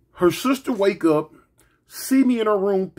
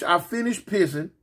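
ordinary talk

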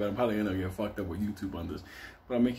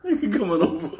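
high, laugh-choked invitation; laughing through it; 11 semitones higher than usual. Real, not acted. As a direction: crack up while quoting her. Cracking up while voicing her.

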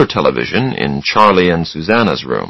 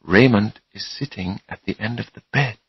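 hushed, much softer warning; quiet voice; 9 dB quieter than usual. A narrator's reading. Susanna warns Charlie that Raymond is there, quiet voice. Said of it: "she said in a quiet voice".

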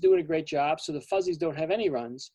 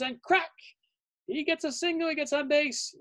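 excited play-by-play; 8 semitones higher than usual. A narrator's reading. Big hit in the game.

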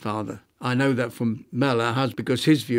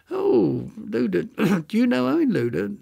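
imitated curious recognition; 8 semitones higher than usual. Stranger asks lawyer daughter about her surname, high curious voice. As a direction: quote a stranger's question, pitched well up.

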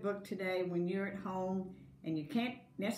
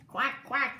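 higher playful quack quack; duck quack; 5 semitones higher, 5 dB louder than usual. Acted. Teacher voicing the duck in a picture book, playful quacks. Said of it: "called the duck".

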